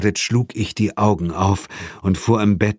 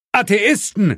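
high cry of false alarm; shouting; 12 semitones higher, 3 dB louder than usual. A narrator's reading. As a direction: shout it to make them turn round. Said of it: "schrie ich". (schreiend, hohe Stimme)

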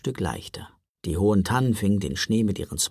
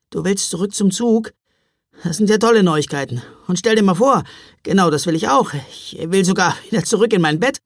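frustrated sarcasm; 7 semitones higher, 7 dB louder than usual. A narrator's reading. Frustrated brother snaps back.

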